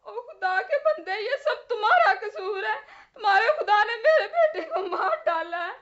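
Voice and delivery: crying through bitter reproach; tearful accusation. Acted. Grieving mother blames the prophet for the death, tearful accusation. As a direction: sob out the blame, building a little in force.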